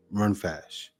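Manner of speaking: usual speaking voice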